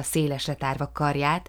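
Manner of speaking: plain reading voice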